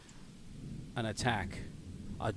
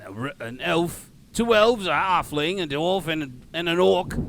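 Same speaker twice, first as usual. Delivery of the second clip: loud, raised Cockney guard voice; amateur Cockney sentry; shitty Cockney accent; 6 semitones higher, 7 dB louder than usual. Acted. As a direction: size up strangers in bad Cockney. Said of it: "my shitty Cockney accent".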